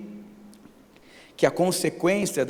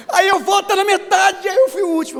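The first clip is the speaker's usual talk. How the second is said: laughing; 9 dB louder than usual. Real, not acted. Excited retelling, laughing.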